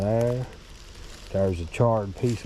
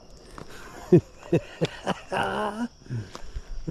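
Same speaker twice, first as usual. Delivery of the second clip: high giggling laugh; 13 semitones higher than usual. Real, not acted. Laughing at beaver.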